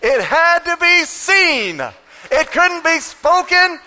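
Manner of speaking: high, loud, slow sermon climax; preaching at full force. Acted. Preacher to his church, preaching at full force. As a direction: drive it home to the church.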